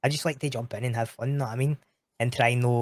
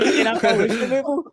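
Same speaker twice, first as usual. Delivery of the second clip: laughing; 11 semitones higher, 6 dB louder than usual. Real, not acted. Laughing at the absurd Subaru story.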